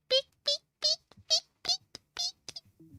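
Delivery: very high beep beep noises. Acted. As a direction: squeak like a robot penguin. Penguin noise.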